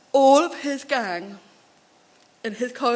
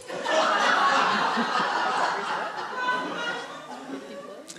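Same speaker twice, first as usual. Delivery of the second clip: breathy laugh, much higher; airy high laugh; 13 semitones higher, 3 dB quieter than usual. Real, not acted. Speaker laughs at the volunteer's cheeky joke, airy and high.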